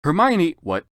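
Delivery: can't believe it; baffled surprise. Real, not acted. Reader stunned by the author's Hermione, baffled outburst.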